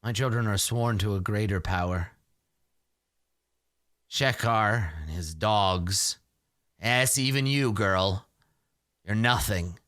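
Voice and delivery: low, deliberate elder with long pauses; ominous old Granny. Acted. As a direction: intone a dark warning, low and measured. Granny warns of greater power.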